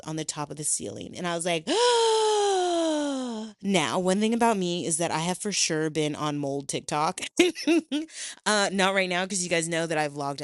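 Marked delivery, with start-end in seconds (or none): 1.7-3.6 sharp high gasp of horror; big dramatic gasp; loud shocked gasp. Retold or imitated. Sees mold on ceiling. 7.2-8.4 high laugh. Real, not acted. Laughing at herself.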